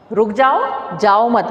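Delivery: ordinary talk, nothing marked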